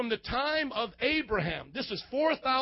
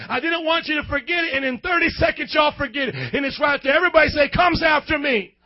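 loud, rising preacher shout; full-force preaching; 4 semitones higher, 8 dB louder than usual. Real, not acted. Preacher shouting at forgetful congregation. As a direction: drive it home to the crowd.

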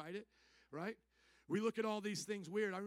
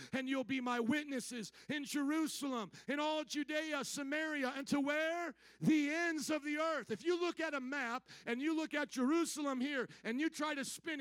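raised, driving preacher's delivery; forceful preaching; 5 semitones higher, 4 dB louder than usual. Acted. Preacher quoting scripture to the congregation, forceful preaching. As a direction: proclaim the verse, higher and insistent.

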